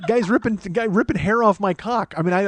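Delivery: normal talking voice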